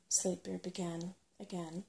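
ordinary delivery, nothing marked

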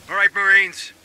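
rally the marines before the mission; rallying call. Acted. Sergeant rallies his squad.

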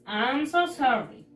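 normal talking voice

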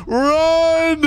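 high loud yell; panicked shout. Real, not acted. Gamer fleeing the woman, shouting to run. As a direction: yell it as you bolt.

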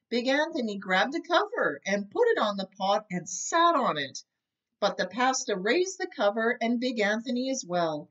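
plain reading voice

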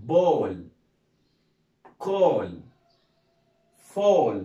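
ball, call, fall each said loud with a falling tune and long pauses; loud classroom drill of ball call fall; repeat-after-me drill. Shown as a demonstration. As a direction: lead the class through the word list. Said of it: "نقول تاني كده مع بعض".

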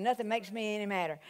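ordinary delivery

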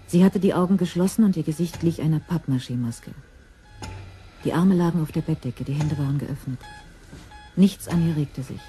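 ordinary delivery

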